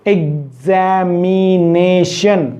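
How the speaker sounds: एग्जामिनेशन slowly split as एग्-ज़ा-मि-ने-शन; five separate syllables. Shown as a demonstration. Teacher counting syllables for learners, slowly syllable by syllable. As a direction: give each syllable of एग्जामिनेशन its own beat. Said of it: "five syllable".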